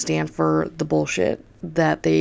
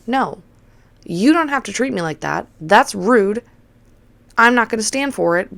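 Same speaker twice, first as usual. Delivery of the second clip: raised pitch, emphatic objection; retold firm protest; 5 semitones higher, 4 dB louder than usual. Bartender refusing to be treated rudely, indignant. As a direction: push back hard, retelling the argument.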